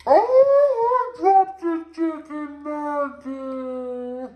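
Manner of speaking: loud high "oh", then slow; dropped something, sudden dismay. Real, not acted. Drops nugget.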